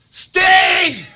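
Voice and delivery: loud high shout down the hall; retold yell, loud and high; yelling a name. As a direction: call out Steve across the office.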